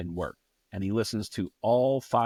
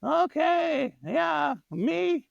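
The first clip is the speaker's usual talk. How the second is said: put-on high German-accented moan ending in a shout; a rock singer whining mid-show; 5 dB louder, much slower than usual. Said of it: "and he goes".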